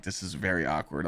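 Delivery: normal talking voice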